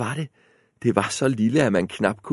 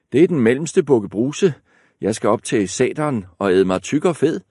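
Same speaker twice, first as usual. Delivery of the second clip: brisk, louder middle goat; less thin voice; 4 semitones higher, 5 dB louder, faster than usual. Acted. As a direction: answer quick and a bit bolder. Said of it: "Den stemme var ikke helt så spinkel".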